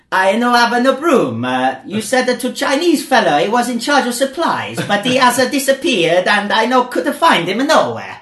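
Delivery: put-on loud, high broken-English Italian accent. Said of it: "The Italian replied".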